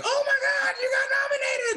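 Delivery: high falsetto mock shout; excited squeal. Publicist calling the actor with nomination news, mock excited shriek.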